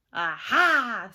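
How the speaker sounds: loud gotcha exclamation; a loud, pouncing aha; triumphant discovery. A narrator's reading. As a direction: catch them out, loudly. Swamp finds the mask.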